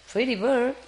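high sing-song Pretty bird to a pet; cooing pet talk. Real, not acted.

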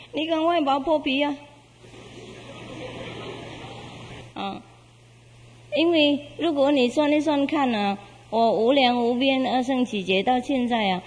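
a kind creature delighted to give itself; joyful golden beast. Acted. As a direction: golden beast happily offering its hide to the hunter.

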